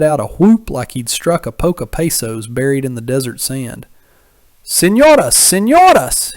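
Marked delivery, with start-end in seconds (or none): 4.6-6.3 loud, high whooping call; calls out loud and high, overjoyed to spot them; hollering. A narrator's reading. Berrios hollering across the plaza at the two women, loud and high. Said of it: "he hollers".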